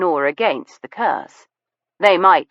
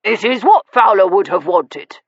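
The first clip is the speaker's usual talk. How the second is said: pompous; 4 semitones higher, 4 dB louder than usual. A narrator's reading. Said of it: "puffing out his chest".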